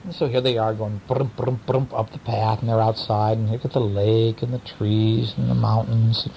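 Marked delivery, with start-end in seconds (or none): none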